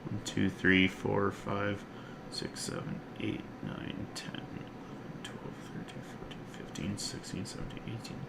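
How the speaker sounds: counting under his breath, fading to a whisper; mumbled tally to himself; soft count trailing into a whisper. Real, not acted.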